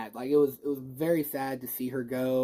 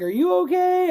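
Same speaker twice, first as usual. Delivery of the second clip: much higher, louder worried question; concerned MJ; 7 dB louder than usual. Retold or imitated. MJ checking on Peter after the fall, high and worried. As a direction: ask it like a shaken friend. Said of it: "She's like".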